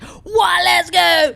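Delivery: high, loud rallying yell; imitated crowd shout. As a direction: holler like a fan at the front. Imitating legends yelling at the front.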